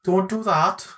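high-pitched Welsh sing-song; hammed-up Welsh impression; Welsh accent. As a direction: plead in a put-on Welsh lilt. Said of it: "Yeah, that's Wales".

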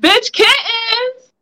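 high, drawn-out name call. Real, not acted. Host calls on friend who dozed off, calling out. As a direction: sing out the name, stretched long.

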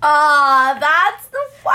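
high, loud aww of sympathy; sympathetic cry. Real, not acted. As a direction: cry out in shared dismay. Sympathy for friend zone.

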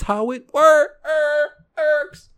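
high slow goofy tone; goofy put-on voice. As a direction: drawl it out playfully. Drawn-out silly voice.

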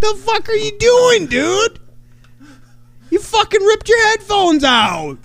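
high, startled yelp at his cohost; shocked outburst. Real, not acted. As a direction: caught completely off guard, voice jumping high. Cohost rips headphones out.

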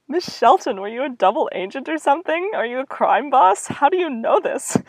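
high, loud amazed questions; stunned girl grilling the cook, high and loud; astonished. Acted. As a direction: gasp it out in disbelief. Shocked at crime boss friend.

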